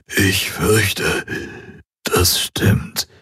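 weak breathless whisper from a dying man; faint whisper. Acted. Dying Hawks agreeing with Kirai in a weak whisper. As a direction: barely breathe the words out.

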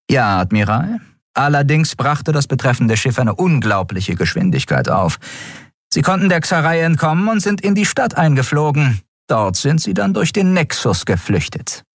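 höhere, militärisch knappe Meldestimme; sachliche Offizierin. A narrator's reading. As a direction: Meldung an den Vorgesetzten. Shia berichtet. (erste Offizierin meldet dem Admiral sachlich, höher)

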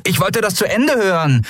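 high-pitched indignant objection; protesting. A narrator's reading. Miko objects to the broadcast being cut off, protesting. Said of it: "protestierte Miko".

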